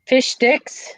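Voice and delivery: Fish sticks? said high with a rise; teasing pronunciation. Shown as a demonstration. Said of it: "nobody can pronounce fish sticks correctly".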